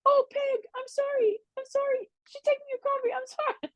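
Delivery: very high, fading, breathless apology; flustered apologetic lady. Retold or imitated. The dog owner apologizing to Peg as her dog drinks the coffee, very high and fading. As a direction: apologize in a rush as your dog steals coffee. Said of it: "she's like".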